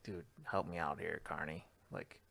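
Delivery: hushed and sly; quiet request to the carny for help; conspiratorial. Retold or imitated. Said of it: "give him the old".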